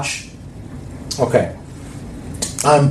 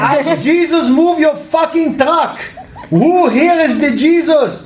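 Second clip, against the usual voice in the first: put-on high, slow Israeli accent yelling across a store; heavy Israeli accent; 11 semitones higher, slower than usual. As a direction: shout it in a thick Israeli accent. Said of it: "in that fucked up accent".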